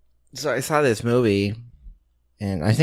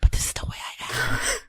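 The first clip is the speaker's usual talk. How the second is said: hushed whiny whisper; whispered protest. Retold or imitated. Mumbling actor protesting to the sound guy in a whisper. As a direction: whine it under your breath.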